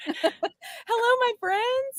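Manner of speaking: high playful hello; high sing-song. Real, not acted. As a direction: sing out the greeting. Sing-song greeting of guests.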